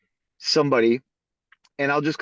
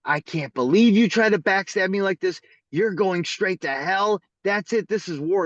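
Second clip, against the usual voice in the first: high-pitched retold tirade; angry accusation; 6 semitones higher than usual. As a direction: declare war like a betrayed spouse. Betrayed wife's angry call retold.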